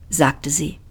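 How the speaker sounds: ordinary delivery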